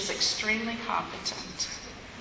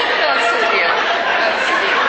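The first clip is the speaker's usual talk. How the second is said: laughing; 3 semitones higher, 10 dB louder than usual. Real, not acted. Still laughing at tote bag joke.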